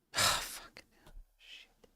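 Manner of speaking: whispered swearing under breath, slow; muttered curse. Real, not acted. Streamer swearing softly when his script fails again. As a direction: curse quietly to yourself.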